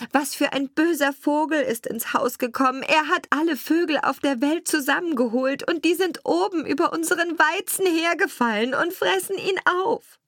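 very high-pitched female role voice, climbing; distressed carter's wife. A narrator's reading. Wife to her husband about birds eating the wheat, high alarmed voice. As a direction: report the disaster with rising alarm. Wife reports the bird invasion.

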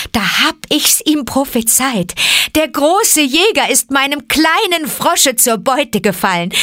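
high, emphatic mother's voice; exultant Mother Wolf. Acted. Mother Wolf seeing the bloody hide, exultant. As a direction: praise your child with fierce pride.

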